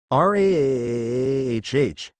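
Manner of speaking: a roar held long and slow; slow drawn-out monster roar. A narrator's reading. Monster roars before it jumps at Hajime, slow and drawn out. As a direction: stretch out a monster's roar, very slowly. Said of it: "let out a roar and jump out".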